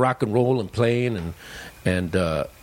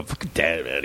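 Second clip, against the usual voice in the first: brother's muttered line; 9 semitones lower, 6 dB quieter than usual. Retold or imitated. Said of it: "my brother just cracking up and go".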